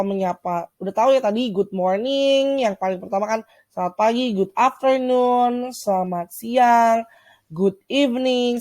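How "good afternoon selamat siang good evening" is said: slow model reading. Shown as a demonstration. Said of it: "teacher models greeting".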